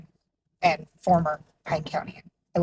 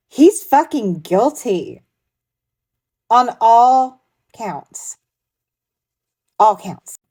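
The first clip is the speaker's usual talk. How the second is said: slow, louder and higher emphatic triumph; triumphant; 5 semitones higher, 6 dB louder, much slower than usual. Real, not acted. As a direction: hammer home the verdict. Triumphant reaction to guilty verdict.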